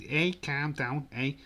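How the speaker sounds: high, loud Scouse impression; hammed-up Scouse telling people off; Liverpool accent. Shown as a demonstration. Streamer playing a Liverpudlian watching footy, high loud Scouse accent. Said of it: "Liverpool says".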